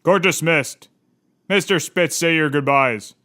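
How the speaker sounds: a stern judge sending a man away. Acted. Judge tells Mister Spitz to say goodbyes, low and firm.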